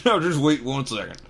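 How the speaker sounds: loud angry giant; angry Hagrid. Acted. Hagrid leaping up at the Dursleys, angry. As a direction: rise up in anger.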